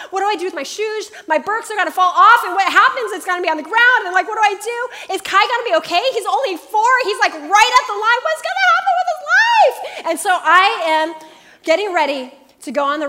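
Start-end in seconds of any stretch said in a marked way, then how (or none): 0.1-9.8 higher, louder, fast panicked questions; frantic worry. Retold or imitated. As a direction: spiral into worried-mom panic, climbing up. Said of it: "I'm like".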